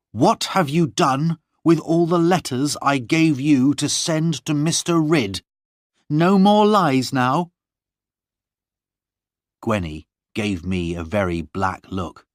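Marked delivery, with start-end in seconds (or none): 0.1-7.5 high, louder, slowing on the last words; scolding a lying servant; stern mistress. Acted. Lorna confronts servant.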